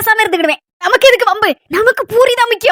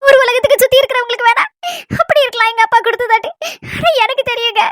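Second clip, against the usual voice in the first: halting, sobbing explanation; tearful; 4 semitones higher than usual. Acted. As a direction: choke out the words between sobs. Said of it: "சரி, அழாத". (அழுகையோடு தடுமாறிப் பேசும் குரல்)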